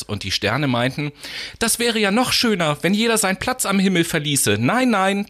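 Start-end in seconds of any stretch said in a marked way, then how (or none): 1.6-5.3 higher, faster indignant chorus; indignant stars. Acted. Stars reject the little star's wish, high and indignant. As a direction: huff at the very idea, quickly.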